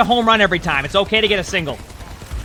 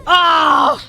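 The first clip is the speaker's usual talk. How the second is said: dismayed cry; 13 semitones higher, much slower than usual. Real, not acted. Streamer reacting to a lost fight, cries out in dismay. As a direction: groan out in real dismay. Watching player barely lose fight.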